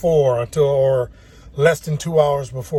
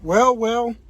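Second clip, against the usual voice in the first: smug well well well; 8 semitones higher, 4 dB quieter, much slower than usual. Real, not acted. Gloating over good luck.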